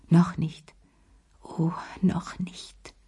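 geflüstert, langsam, für sich; zu sich selbst. A narrator's reading. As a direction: sich selbst leise ermahnen. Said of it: "sagte sie sich". (leise zu sich selbst gesagt)

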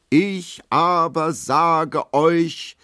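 hoch und laut, ganz langsam herausgebrüllt; brüll es wie ein entrückter Prediger, langsam; brüllen mit Löwenstimme. A narrator's reading. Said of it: "brüllt er mit Löwenstimme". (langsames, lautes Predigerbrüllen)

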